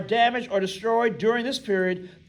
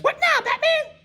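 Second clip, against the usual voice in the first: much higher, cartoonish toy voice with a laugh; Robin doll voice. Teacher voicing the Robin doll for the class, high toy voice. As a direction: squeak it out like a talking toy sidekick. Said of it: "And the Robin doll says".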